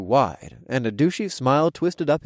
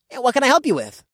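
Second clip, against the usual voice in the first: friendly student voice; 12 semitones higher, 4 dB louder, faster than usual. A narrator's reading. RA character voice.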